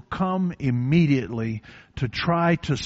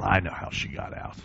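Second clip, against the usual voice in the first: hushed aside; 7 semitones lower, 6 dB quieter than usual. Real, not acted. Quiet aside about God's help.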